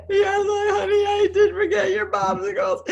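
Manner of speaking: high, teary voice, close to crying; mother choking up over popsicles; tearful mother. Retold or imitated. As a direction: answer the child, half laughing half crying. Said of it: "retold reply to daughter".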